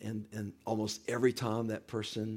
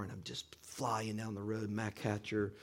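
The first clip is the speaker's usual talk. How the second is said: ordinary talk, nothing marked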